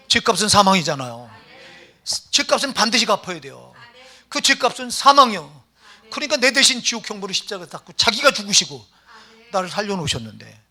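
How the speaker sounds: loud, slowing emphatic preaching. Real, not acted. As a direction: hammer the point home. Preaching at full force.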